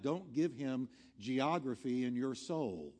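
ordinary talk, nothing marked

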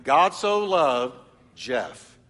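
slow it down and lean on "Jeff"; a preacher modelling the verse with his own name; name put in slowly. Shown as a demonstration. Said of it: "Replace the pronouns or people in the verse with your own name".

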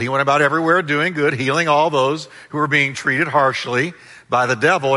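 usual speaking voice

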